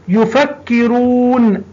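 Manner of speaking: يفكرون said slowly with a long oo; stretched reading. Shown as a demonstration. Teacher stretching يفكرون to find the long vowel. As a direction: draw out the oo in يفكرون. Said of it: "المد رو".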